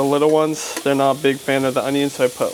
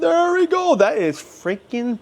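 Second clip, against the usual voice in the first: delighted; 13 semitones higher, slower than usual. Real, not acted. Pleased at perfect buns.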